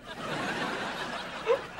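delighted laugh. Acted. Babysitter recognizing a grown-up boy, delighted laugh. Recognizing her former charge.

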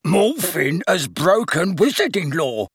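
mocking, drawn-out sing-song imitation; pompous sing-song. A narrator's reading. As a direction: mimic him pompously, slow and high. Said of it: "making it pompous and sing-song".

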